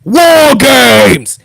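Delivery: loud booming announcement; dramatic announcer voice; Regal announcement yell. Retold or imitated. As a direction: proclaim it like a grand ringside announcer. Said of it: "lower your volumes for this".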